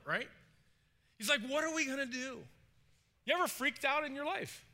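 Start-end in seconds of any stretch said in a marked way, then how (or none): 1.4-2.5 high, helpless mock panic; freaking out. Servant facing an enemy army, freaking out. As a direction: blurt a panicked question, pitched up. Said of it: "He's freaking out".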